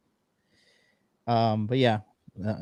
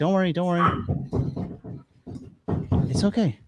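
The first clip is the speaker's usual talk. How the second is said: soothing the dog; 4 semitones higher, faster than usual. Real, not acted. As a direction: coax the frightened dog to chill. Soothing dog named Happy.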